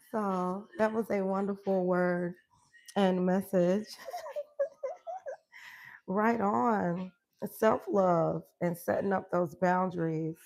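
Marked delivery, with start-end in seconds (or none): 3.9-5.3 high falling squeal of a laugh. Real, not acted. Laughing with the host, high squeal.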